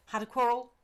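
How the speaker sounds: high, loud, eager servant's voice; eager old servant. Acted. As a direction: pitch up as the startled, fond old retainer. Servant William's voice.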